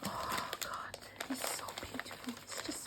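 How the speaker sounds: moved whisper over a gift; tearful whisper. Real, not acted. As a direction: whisper through happy tears. Overwhelmed by gift, in tears.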